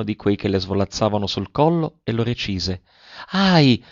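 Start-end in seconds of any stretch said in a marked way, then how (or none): 3.3-3.8 a sudden high little cry of alarm; startled exclamation. A narrator's reading. Girl startled when her cousin cuts a curl, a sudden high cry. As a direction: yelp as if a curl were snipped without warning. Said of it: "esclamò la poveretta".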